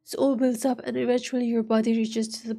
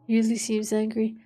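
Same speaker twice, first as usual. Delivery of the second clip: ordinary delivery, nothing marked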